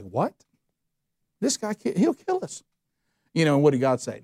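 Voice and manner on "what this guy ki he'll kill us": rising to a high panicked squeak; like a man told to visit his killer; alarmed disbelief. Retold or imitated. Ananias protesting to God about Saul, high alarmed disbelief.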